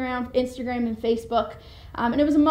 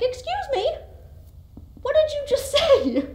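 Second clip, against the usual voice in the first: much higher mock-indignant parent voice; stern parent. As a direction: put on a shocked, scolding parent's tone. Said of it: "you'd be like".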